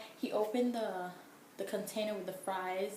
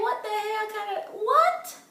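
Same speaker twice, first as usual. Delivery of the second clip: loud, high-pitched incredulous outburst; can't believe what you just heard; outraged disbelief; 14 semitones higher, 11 dB louder, slower than usual. Retold or imitated. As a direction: cry out "what?" in appalled disbelief. Outraged reaction to the date's remark.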